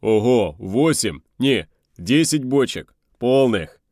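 loud, amazed tallying; loud and thrilled, stretching the words; excited shout. Acted. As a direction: call out your lucky find. Excitedly calling out a find.

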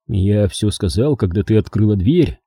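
usual speaking voice

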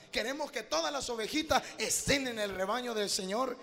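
building to loud passionate preaching; preaching at full force. Real, not acted. (predicación con fervor creciente)

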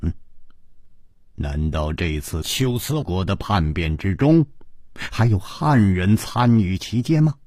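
low, pondering question; puzzled concern. A narrator's reading. Chu Liuxiang wonders who joined the rebellion, puzzled concern. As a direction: think it through aloud, voice low. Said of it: "皱皱眉说".

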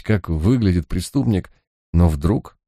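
plain reading voice

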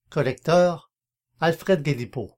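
normal talking voice

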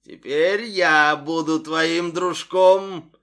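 slow loud monster croak; hoarse gurgling voice. Acted. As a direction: drag it out like a drowned ghoul. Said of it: "хриплый булькающий голос". (медленный хриплый булькающий голос)

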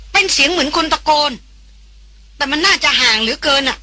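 high-pitched urgent woman; agitated woman, voice pitched well up; excited, breathless. A narrator's reading. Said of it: "เสียงของหล่อนตื่นเต้น เร่าร้องกระหืดกระหอบ".

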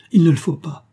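high, loud protest; sudden emphatic plea; exclaimed. A narrator's reading. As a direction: cry out to stop them. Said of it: "m'écriai-je".

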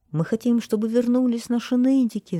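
high, loud demand; crowd shouting. A narrator's reading. Townspeople demanding the whiners return, loud shouting. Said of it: "закричала толпа".

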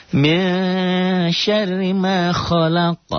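high, slow chanted recitation; Quran recitation demo. Shown as a demonstration. Teacher chanting the verse for students, slow and high. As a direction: draw out the Arabic like a reciting teacher. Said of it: "Qalqalah kubro tuh".

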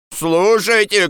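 high-pitched urgent call; shouting. Acted. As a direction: call out to be heard. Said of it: "крикнул Андрес". (призывный крик)

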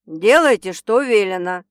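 loud, stern order; stern command. A narrator's reading. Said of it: "строгий голос хозяина дома".

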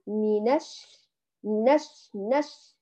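منش with the n running straight into the sh; joined reading. Shown as a demonstration. Teacher shows the students the joined sound, clear demo. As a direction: run the n straight into ش. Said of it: "فنقول".

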